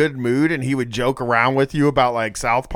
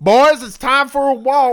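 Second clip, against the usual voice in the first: prison guard; 13 semitones higher, 6 dB louder than usual. Pretend. Mock warden calling the inmates.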